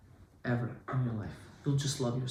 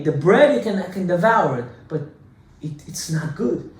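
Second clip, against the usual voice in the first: ordinary delivery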